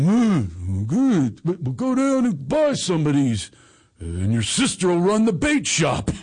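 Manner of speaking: father impression. Father planning the family shark business, father impression. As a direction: hum in approval, then announce the plan. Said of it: "so now you go".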